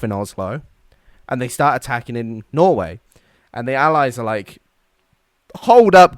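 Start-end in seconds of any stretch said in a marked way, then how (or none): 5.6-6.2 high, loud call to halt; mock alarmed objection. Allies reacting to the Norway invasion, loud mock objection. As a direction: call out to stop everyone.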